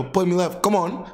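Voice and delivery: mock English footballer, pitched well up; English accent. Footballer begging a teammate for the ball, hammy English accent. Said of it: "the English accent when it comes to soccer terms".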